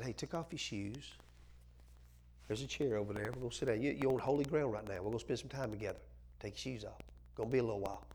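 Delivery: soft, unhurried invitation; a lightly voiced God inviting a friend to sit; a hint of a tender host; gentle voice of God.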